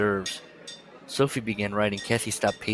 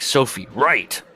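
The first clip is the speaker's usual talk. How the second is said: slow, louder urgent order; commanding call; 3 semitones higher, 5 dB louder, slower than usual. Retold or imitated. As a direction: bark the name then the order. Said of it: "Then she's like".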